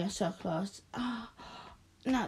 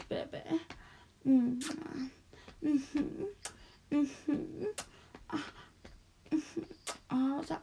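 ordinary talk, nothing marked

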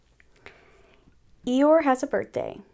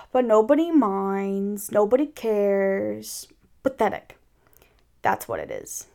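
gloomy donkey. Acted. Eeyore lamenting at his reflection, gloomy. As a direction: sigh and drag the words out dolefully.

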